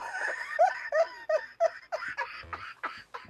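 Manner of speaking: high, squealing burst of laughter; laughing at her viewers' comments, high and cracking up; big real laugh. Real, not acted. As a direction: break up laughing at the chat. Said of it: "Y'all crack me up".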